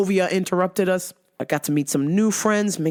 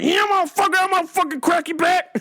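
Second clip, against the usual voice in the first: fighter trash talk; 10 semitones higher than usual. Retold or imitated. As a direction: growl it like a hyped boxer. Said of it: "When he's like".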